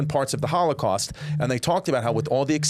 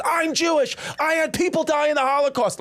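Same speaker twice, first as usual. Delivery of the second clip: very high-pitched mock outraged scream; imitated screaming. Offended woman screaming at fellow plane passenger, high mock scream. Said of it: "like, screaming at me".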